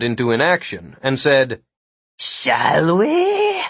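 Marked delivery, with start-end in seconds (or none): 2.2-3.6 very high, slobbering shout; drooling monster. A narrator's reading. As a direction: monster spitting as it talks. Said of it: "His mouth emitted as much saliva".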